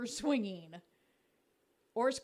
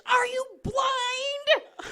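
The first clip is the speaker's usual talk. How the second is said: loud, high, drawn-out heckle; yelling fan; 14 semitones higher, 11 dB louder, much slower than usual. Acted. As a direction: yell slowly at the umpire like an outraged fan. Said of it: "fans yelling at our umpires".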